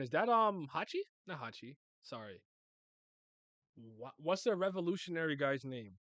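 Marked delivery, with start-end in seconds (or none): none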